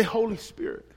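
pleading prayer, building from hushed to urgent; acted prayer. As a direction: worshipper voiced praying in desperation. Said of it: "you begin to say".

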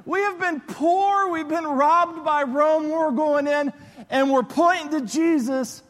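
high-pitched, hyped-up disciples; freaking out with excitement. Acted. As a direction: crow it triumphantly as the excited crowd. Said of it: "they're, like, freaking out".

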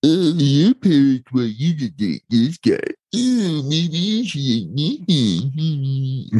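high, mocking reality-show voice. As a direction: sneer at the cheesy dating show. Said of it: "wasn't just the typical, like".

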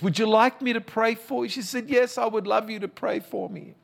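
gently urgent, like he is back at the bedside; preach. Retold or imitated. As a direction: pastor recounting what he told his dying aunt, high and fervent. Retelling speech at deathbed.

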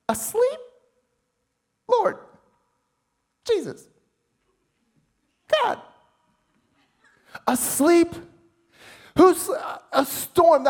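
high, stunned disbelief at each word; can't believe he's sleeping through this; incredulous disbelief. Real, not acted. Preacher marvelling to his congregation that Jesus sleeps, incredulous.

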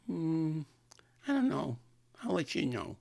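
high, noncommittal impediment voice; imitated woman's high, slurred reply; harelip date. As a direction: brush him off in a cleft-lip voice. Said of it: "talking like me for".

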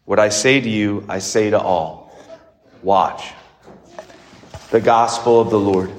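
measured liturgical reading voice; formal Gospel reading. Acted. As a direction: proclaim the Gospel solemnly.

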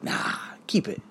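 near-whispered, dismissive answer to himself; hushed self-reply. Acted. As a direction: wave the idea away under your breath. Answers his own inner question.

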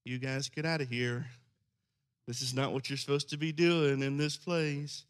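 soft, timid telling-off; a gentle Jesus who barely scolds; meek mild rebuke. Shown as a demonstration. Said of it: "what I read is not this".

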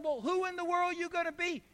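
a mother humoring her son; lightly voiced mother.

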